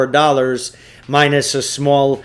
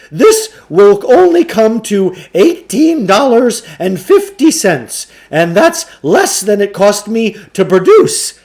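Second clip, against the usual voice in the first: higher, louder salesman's patter; Samuel Klugarsh voice; 8 semitones higher, 9 dB louder than usual. Acted. Shopkeeper pushing a trade-in on the boys, higher, louder salesman voice. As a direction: sell it like an oily bookshop owner.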